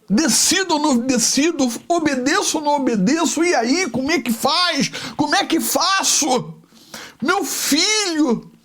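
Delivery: high, anguished inner cry that slows on the last words; Abraham agonizing over his son, high anguish; anguished father. Acted. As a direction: torn father, pleading to himself. Abraham torn before sacrifice.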